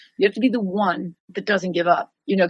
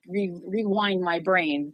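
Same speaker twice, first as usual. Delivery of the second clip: usual speaking voice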